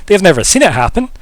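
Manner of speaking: ordinary talk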